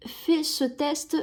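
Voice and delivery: ordinary delivery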